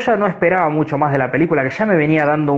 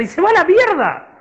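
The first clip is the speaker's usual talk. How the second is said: excited; 11 semitones higher than usual. Real, not acted. Excited retelling, high and loud.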